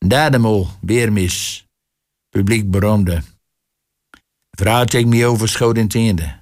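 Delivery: ordinary delivery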